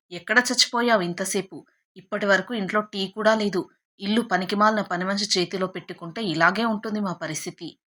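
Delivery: ordinary delivery, nothing marked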